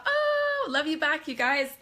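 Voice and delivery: touched and thrilled; delighted gush. Real, not acted. Reacting to hearts in chat.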